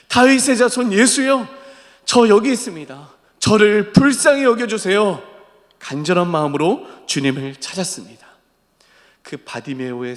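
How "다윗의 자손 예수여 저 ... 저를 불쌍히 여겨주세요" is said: high, insistent pleading call; louder crying out. Acted. Preacher as Bartimaeus shouting to Jesus over the crowd, urgent plea. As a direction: cry out earnestly to be noticed. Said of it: "더 크게 소리 지르는 겁니다".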